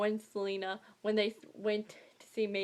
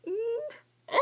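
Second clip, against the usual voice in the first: short high-pitched whimper, near tears; high little almost-crying noise; mock whimper; 12 semitones higher than usual. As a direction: squeak out a tearful little whimper. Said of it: "I wasn't crying".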